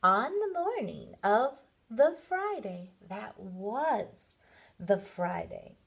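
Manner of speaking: ordinary delivery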